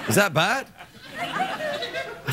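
chuckling through the words; laughing. Real, not acted. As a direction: laugh it off after nearly falling. Laughing after stumble.

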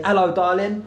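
Essex "Hello, darling," said with a cockney lilt; Essex accent. Shown as a demonstration. Vlogger teaching the shop auntie an Essex greeting, in a light Essex accent. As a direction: show her how a true Essex geezer says it. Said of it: "to learn Essex accent".

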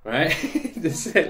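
normal talking voice

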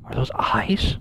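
tense whispered question; nervous whisper. Real, not acted. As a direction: whisper it, afraid to be heard. Spotting eyes in the dark.

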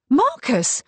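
high, loud outcry; shocked cry. A narrator's reading. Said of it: "cried Kate".

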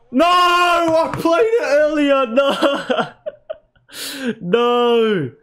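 high, slow, laughing wails of no; laughing despair. Real, not acted. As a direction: laugh through the disbelief. Blunder costs a goal, laughing.

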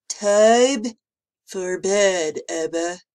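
loud congested monster order; stuffed-up nose. A narrator's reading. Mucus monster sending Emma to bed, stuffed-up voice. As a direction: announce it through a badly blocked nose. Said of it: "through sniffled noses".

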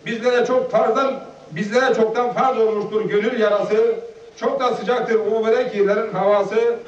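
chant-like recital, each verse falling at its end; poem recitation. Acted. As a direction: recite a devotional farewell poem in steady rhyme. Recites own hajj farewell poem. (ezberden şiir okur gibi)

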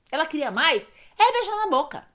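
usual speaking voice

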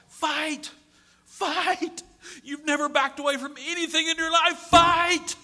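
high, loud desperate yelling. A narrator's reading. Husband yelling at his dead wife to fight, frantic yell. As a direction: yell her back to life. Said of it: "he starts yelling at his dead wife".

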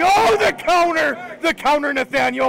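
loud, high outburst easing slightly; astonished caster yell; excited exclamation. Real, not acted. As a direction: shout the big play to your co-caster. Caster calls out a surprise counter.

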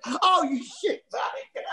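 slow, high, laughing through it; drawn-out laughing curse at the prankster; laughing insult. Retold or imitated. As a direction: caught out and cracking up. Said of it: "I went".

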